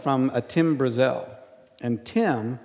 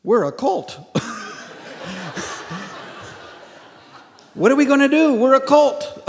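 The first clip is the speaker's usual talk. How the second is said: put-on panicked young leaders; 10 semitones higher than usual. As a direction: play the startled insiders, pitched up. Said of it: "they looked at their own stuff and they went".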